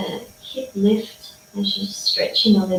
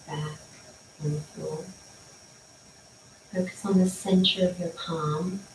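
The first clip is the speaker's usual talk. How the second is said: guided meditation; 7 dB quieter than usual. Acted. Leads body scan meditation.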